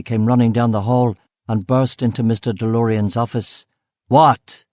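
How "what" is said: loud, startled one-word yell. A narrator's reading. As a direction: yell it out at whoever burst in. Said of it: "Delorean yelled".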